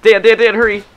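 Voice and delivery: frantic yelling for a teammate; panicked shouts, pitch shooting up; panicking gamer. Real, not acted. Being hunted, calling teammate.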